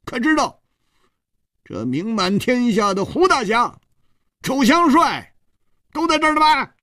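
a flustered man blustering high; flushed indignant. Acted. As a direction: stand up to him, face burning. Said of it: "涨红了脸说".

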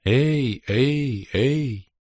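fading echo of a call; distant echo. A narrator's reading. Cave vault throws the daughter's shout back, a distant echo. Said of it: "С большим запозданием от высокого свода донеслось".

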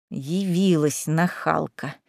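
low, soft, slow hiss under her breath; hissed aside. A narrator's reading. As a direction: hiss it so the guests can't hear. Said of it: "прошипела Лиза".

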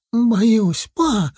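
put-on high, frightened child voice; boy's cry. A narrator's reading. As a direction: cry out to dad like a scared boy. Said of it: "кричал в ответ Саня". (испуганный крик мальчика)

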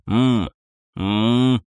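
long, noncommittal "mmm"; drawn-out hum. A narrator's reading. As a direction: hum a minimal reaction, stretched out. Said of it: "хотя бы протяжного". (протяжное мычание)

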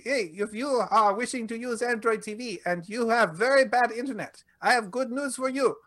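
a deliberately bad Indian accent, like a mock ad read. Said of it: "my bad accent, my bad, horrible accent".

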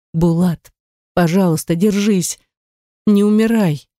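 anguished plea climbing in pitch; tearful pleading. A narrator's reading. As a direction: beg a dying love to hold on. Begging her dying beloved to live.

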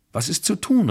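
high-pitched exclaimed question; ausrufend. A narrator's reading. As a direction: call it out, pitch jumping up. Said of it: "rief ich". (hoch ausgerufene Frage)

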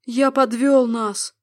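loud, slow tearful despair. A narrator's reading. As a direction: cry out the guilt. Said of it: "со слезами на глазах". (со слезами, громко)

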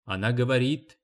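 ordinary delivery, nothing marked

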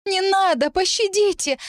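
очень высокая мольба о пощаде; вскричи высоко, умоляя; отчаянный вскрик. A narrator's reading. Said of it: "вскричала она". (истеричная мольба, высоким голосом)

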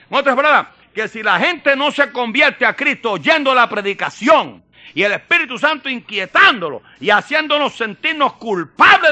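high, loud preacher's cry; preaching at full force. Acted. (predicación a pleno pulmón, aguda y fuerte)

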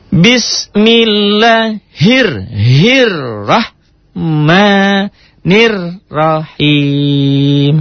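very slow melodic chanted recitation; Quran recitation. Shown as a demonstration. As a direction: chant it out slowly with sweeping tune. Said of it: "Ingat teori yang kalau ada patah".

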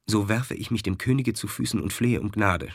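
ordinary delivery, nothing marked

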